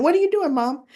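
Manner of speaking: high, mildly loud mock daughter voice; daughters' questioning. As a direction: imitate a daughter catching Mom at something. Daughters walk in on mother.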